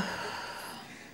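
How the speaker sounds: long, breathy sigh. Acted. Patient's plea ends, long sigh.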